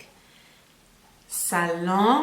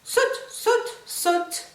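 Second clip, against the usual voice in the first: much higher, loud sing-song saute; excited jumping call; 13 semitones higher, 3 dB louder, much slower than usual. Acted. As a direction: cheer the toy frog up as it jumps. Making toy frog jump.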